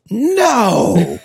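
high, loud laughing mock yell of no; imitating wife's shocked yell at the price; retold yell. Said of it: "and she yelled".

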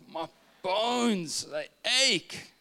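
high, drawn-out mock wail; crying child. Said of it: "Daniel's crying".

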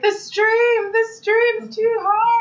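high, slow mock-distressed girl voice; poor girl. Host mimicking a girl under the stream, high distressed wail. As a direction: wail it high, drawing out the end. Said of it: "The poor girl's like".

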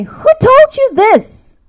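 slow, high, loud demand; scolding shout. Retold or imitated. Guilty mom quoting how she scolded her son, shouting. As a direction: re-enact a mother's outburst, every word drawn out.

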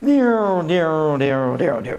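imitated whining noise, sliding down and fading; vocal sound effect, falling in pitch. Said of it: "we hear".

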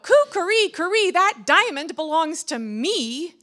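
high, loud rooster crow; indignant crowing cry. A narrator's reading. Rooster robbed of his diamond, indignant cry. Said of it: "very indignantly cried out".

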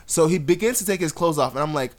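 ordinary talk, nothing marked

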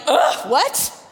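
loud, high, laughing disbelief; shocked ugh with a laugh. Retold or imitated. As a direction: blurt it out, stunned and laughing. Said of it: "but I was like".

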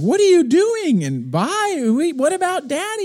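high, loud, drawn-out protest; imitated clamour of upset family; acted-out commotion. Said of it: "I hear this commotion".

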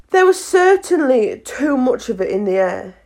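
ordinary delivery, nothing marked

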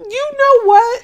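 high squealed laugh; cracking up in disbelief; laughing exasperated repeat; laughing exasperation. Real, not acted. Exasperated at joke answers.

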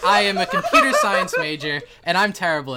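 real loud, high-pitched outburst through laughter; laughing exasperation. Real, not acted. Game master admits he can't describe music, loud laughing exasperation. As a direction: cry out helplessly, laughing at your own failure.

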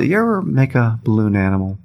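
slow, drawn-out question sinking at the end; deliberate, spaced-out challenge; real slow. Retold or imitated. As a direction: leave long pauses between each phrase. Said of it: "he says it just like that, real slow".

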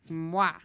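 a loud smacking mwah; a playful kiss sound; blown kiss. Pretend. Grown son sending his mother a kiss, playful smack.

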